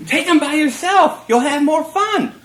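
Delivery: needling her over and over, loud and high; mocking taunt. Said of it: "So I kept laughing and saying".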